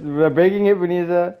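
loud urgent call; alarmed shout. Real, not acted. Alarm calling friend.

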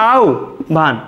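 আহ্বান said as আউ, then ভান, with a pause between; correct pronunciation, syllabified. Shown as a demonstration. Teacher showing students how আহ্বান is really said. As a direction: split it into আউ and ভান. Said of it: "এটাই আমাদের শুদ্ধ উচ্চারণ".

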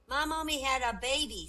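put-on high, loud small-child voice; little kid announcing news in class; little Esther. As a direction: pipe up proudly like a schoolgirl. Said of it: "said little Esther".

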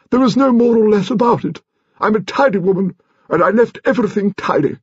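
high-pitched, emphatic grande dame; high, affronted grandmother; indignant old lady. A narrator's reading. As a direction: insist in a very high imperious voice. Voices Lady Lacklander in story.

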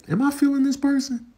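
high, musing inner voice; wondering to oneself. Acted. Dater privately gauging the other person, wondering. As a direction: ponder it in a light high pitch. Imagined inner question on drive.

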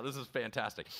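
ordinary talk, nothing marked

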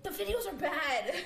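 laughing. Real, not acted. Laughing during challenge.

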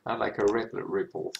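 ordinary talk, nothing marked

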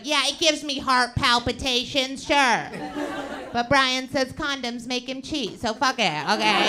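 mocking, breezy sister voice tossing off the risk; a hint of a careless party-girl sister; impression of sister. Comic doing her sister for the crowd, lightly mocking.

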